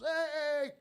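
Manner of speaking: high, loud calling-over voice of the sound guy. Retold or imitated. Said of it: "he goes".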